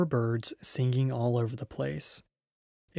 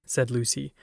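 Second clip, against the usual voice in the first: plain reading voice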